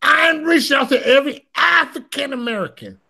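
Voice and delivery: Trump-style stump speech, played up for laughs; quoting Trump. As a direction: ham up Trump working the crowd. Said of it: "Then Trump came down here and said".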